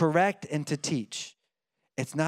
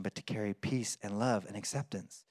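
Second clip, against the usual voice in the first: normal talking voice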